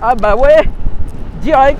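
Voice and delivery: loud, high shout into the wind; shouting over wind. Real, not acted. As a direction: yell it over the rushing air. Riding fast into the wind.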